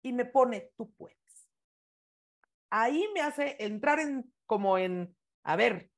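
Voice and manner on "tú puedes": breathy mouthed whisper, no voice. Retold or imitated. Friend at the back of the audience urging her on, whispered. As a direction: soundless encouragement from across a room.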